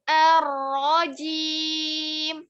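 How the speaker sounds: Ar-rajim chanted very slowly; slow tajwid recitation. Shown as a demonstration. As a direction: draw out Ar-rajim. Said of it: "teacher model".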